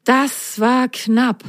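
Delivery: slow, drawn-out relief; relieved. A narrator's reading. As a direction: let the fright go slowly. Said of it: "seufzte Ron erleichtert".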